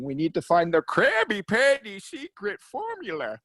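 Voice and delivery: high, nasal cartoon villain; squeaky scheming cartoon villain; Plankton voice. Shown as a demonstration. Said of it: "That's actually pretty good".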